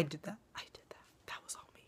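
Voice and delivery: whispered, smug boast; playful whisper. Pretend. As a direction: let them in on a proud secret. Proud secret boast to camera.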